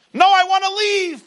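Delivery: high, loud desperate protest; pleading cry. Acted. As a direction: cry out to break free. The person answers Pharaoh.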